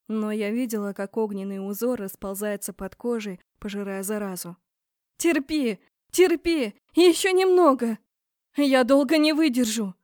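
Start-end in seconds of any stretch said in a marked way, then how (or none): 5.2-9.9 high, loud urgent shouting; desperate cry. A narrator's reading. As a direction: cry out under great strain. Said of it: "вдруг выкрикнула Борн". (отчаянный громкий крик)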